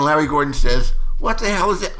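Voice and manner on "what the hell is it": high-pitched puzzled outburst; baffled demand. Retold or imitated. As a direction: act out an impatient, bewildered producer. Reenacts baffled Larry Gordon.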